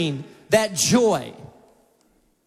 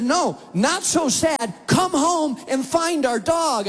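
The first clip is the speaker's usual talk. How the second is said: higher, slow, insistent; wife's insistence; 7 semitones higher, slower than usual. Retold or imitated. Wife on the phone insisting he come home, higher and slow. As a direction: press him firmly, slow and high. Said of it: "And she said".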